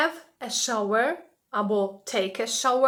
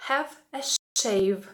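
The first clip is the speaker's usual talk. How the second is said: slow model phrase; slower than usual. Shown as a demonstration. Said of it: "teacher models phrase".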